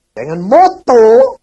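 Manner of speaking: loud, slow sermon exhortation; booming pulpit voice, slow; 1 full-force preaching. Real, not acted. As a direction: drive the point home to the congregation.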